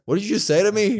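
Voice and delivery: high-pitched mock indignation; lightly acted incredulous challenge; affronted retort. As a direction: imitate someone taking offence at a jab. Acted affronted wrestler in retold quarrel.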